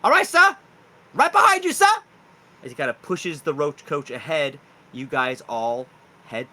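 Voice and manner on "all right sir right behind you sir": high, loud shouted reply; Cockburn. Acted. Driver answers the order to follow, high loud shout. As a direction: call out like an eager underling. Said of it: "So Cockburn goes".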